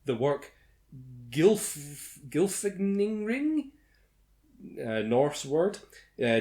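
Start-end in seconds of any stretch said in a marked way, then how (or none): 1.3-3.7 slow, unsure reading; hesitant. Real, not acted. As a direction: sound out an unknown word. Unsure reading of Norse word.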